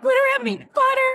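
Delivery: put-on panicked child. Child panicking at birthday party.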